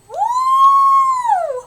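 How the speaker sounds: very high, loud, long whoop; long, piercing ooh of delight; scandalized ooh. Real, not acted. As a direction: hoot at a savage burn. Reacting to a harsh burn.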